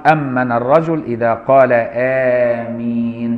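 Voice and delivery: آمين chanted slowly with the first vowel drawn long; slow chanted demo of آمين with a long opening vowel; long-vowel amen. Shown as a demonstration. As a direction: sing out آمين, stretching the opening alif. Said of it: "بمد الهمزة".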